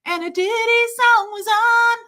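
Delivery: sung way up high and loud; high, loud sung impression of a pop singer. Shown as a demonstration. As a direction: belt it out like a pop star. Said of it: "I don't know how she gets her voice that high, but let's see".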